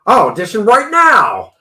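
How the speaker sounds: loud eager burst; eager shout. Retold or imitated. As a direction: blurt it out, insisting. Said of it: "He goes".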